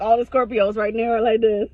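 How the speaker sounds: ordinary delivery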